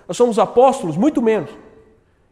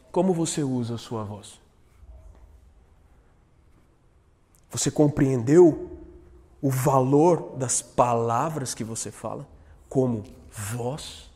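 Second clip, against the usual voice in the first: soft reflective close; 8 semitones lower, 9 dB quieter than usual. Real, not acted. Quiet closing reflection.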